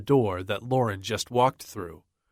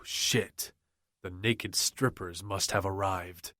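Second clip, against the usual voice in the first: ordinary delivery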